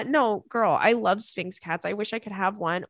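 ordinary talk, nothing marked

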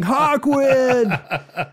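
loud, high, drawn-out heckler's yell; imitated crowd shout, stretched long. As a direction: yell it out like a bored concert crowd. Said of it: "started yelling".

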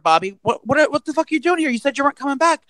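high, disbelieving outburst; imitating a baffled roommate; mock startled roommate. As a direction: act thrown by his return. Roommate confronts supposed Bobby.

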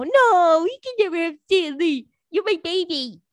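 high, loud doting pet voice. Real, not acted. Talking to her cat Stanley, high doting baby talk. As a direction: coo at the cat. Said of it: "talking to her cat".

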